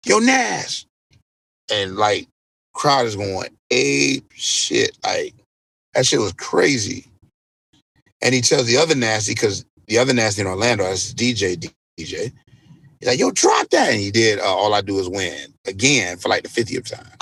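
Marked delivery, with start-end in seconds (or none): 0.1-0.9 high-pitched imitated call to a friend; calling out onstage. DJ calling his friend out before the crowd, high imitated call. 13.2-13.9 very high imitated shout-out to a DJ; stage cue. Headliner cueing the other DJ to play, high imitated call.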